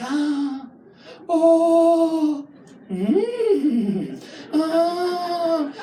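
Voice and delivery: hammed-up moaning; mock moans. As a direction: moan like she's loving it. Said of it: "She's like".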